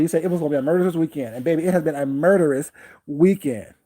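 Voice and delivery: ordinary talk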